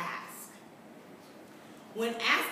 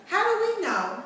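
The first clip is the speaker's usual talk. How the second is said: rising teacher question; 11 semitones higher, 8 dB louder than usual. Shown as a demonstration. Teacher poses question to class.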